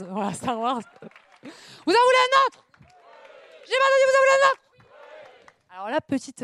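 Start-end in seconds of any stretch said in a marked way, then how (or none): 1.4-4.6 very high, loud teasing squeak; high squeaky voice. Acted. Comedian teasing the audience for another, high squeak. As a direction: squeak the question at the crowd.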